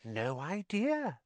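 high, soft, slow hush. Acted. Ghost girl answers Harry in hushed tones. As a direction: hush it like a secret. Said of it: "said Myrtle in hushed tones".

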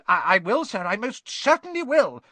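high, loud, stammered obedience; eager grovelling. A narrator's reading. Peeves obeying the Baron, flustered grovelling.

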